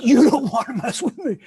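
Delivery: laughing through a puffed-up threat; mock tough guy. Retelling the neighbor's threat, laughing mock tough guy. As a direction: ham the bully again, cracking up. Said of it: "He's like".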